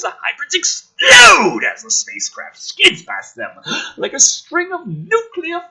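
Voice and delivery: shouted high and loud over the explosions; hyped-up action shout; excited shout. Acted. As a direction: sell the blast like an action trailer. Dramatic reading of explosions.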